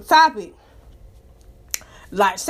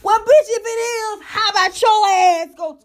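higher, louder and slow, daring them; scornful challenge; 9 semitones higher, 5 dB louder, slower than usual. Real, not acted. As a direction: call them out, slow and taunting. Challenging people online.

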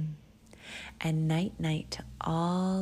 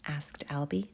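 ordinary delivery

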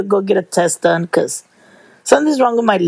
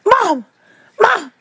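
much higher calling Mom, Mom; 12 semitones higher than usual. Retold or imitated. The daughter calling her mother over, calling out. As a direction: call across to get her attention.

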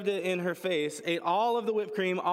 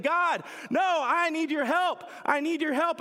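higher, louder pleading cry; desperate plea; 11 semitones higher, 4 dB louder than usual. Retold or imitated. People screaming to God for deliverance, loud pleading. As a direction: beg God for help, slowed and strained.

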